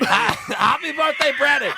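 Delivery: laughing, slow birthday shout; loud. Real, not acted. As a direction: cheer it out loud.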